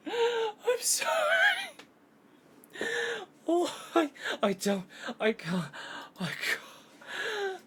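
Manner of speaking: high, slow hammed-up wailing apology; mock sobbing. Streamer parodying the game's dramatic scene, hammed-up sobbing. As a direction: overplay the sobs like a melodrama. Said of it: "from silence to serious voice acting".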